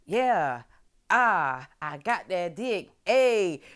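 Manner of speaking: imitating a satisfied woman crowing; playful, self-pleased whooping; happy strut. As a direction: celebrate with drawn-out cheers. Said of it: "she's happy".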